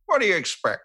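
high-pitched mimicked retort; Matt Berry. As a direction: toss it out high as a quoted reply. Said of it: "Matt Berry and he was like".

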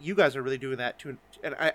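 ordinary talk

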